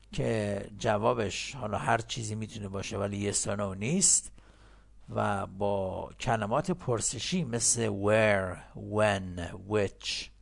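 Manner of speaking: usual speaking voice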